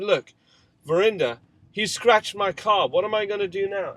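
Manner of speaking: father shouting at his wife Verinda about the scratched car; loud furious protest. Retold or imitated. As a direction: shout your grievance in despair.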